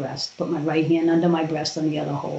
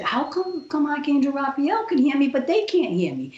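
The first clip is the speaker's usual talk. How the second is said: much higher, puzzled plea; dying woman puzzled no one hears her, much higher; baffled protest; 10 semitones higher than usual. Retold or imitated. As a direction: ask it high, bewildered.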